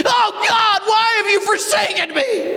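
very high hammed-up wail to heaven; melodramatic despairing cry. Believer rattled by a nasty email, wailing to God. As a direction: cry out like all is lost.

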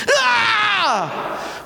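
high, long mock-anguished "ah"; frustrated wailing cry. Leader let down by the city, wailing in frustration. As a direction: let out a theatrical howl of frustration.

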